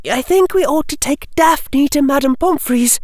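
put-on very high-pitched girl's voice; concerned schoolgirl. Hermione suggesting help for Daphne, high concerned girl. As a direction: play an earnest young witch, pitched high.

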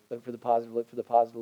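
quiet, intimate repeat of the call; soft urging. Shown as a demonstration. Pastor quietly urging the congregation to look for the positive. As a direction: lean in and say it low. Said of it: "I say it again".

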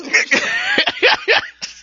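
real laughter, high-pitched. Real, not acted. Laughing at his own freedom-guy bit. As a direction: burst out laughing.

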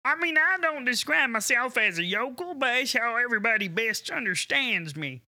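put-on heavy Southern country accent; Southern yokel voice. Guest explains how his Southern voice comes across. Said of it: "If I just come in talking like this".

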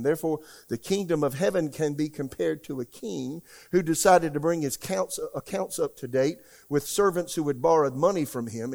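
ordinary talk

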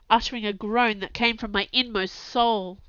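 ordinary delivery, nothing marked

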